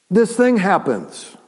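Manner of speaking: ordinary delivery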